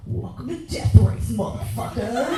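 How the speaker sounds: loud, slow arena-announcer growl; menacing announcer. Acted. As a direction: announce the carnage like a hammed-up host. Persona turns monster in car.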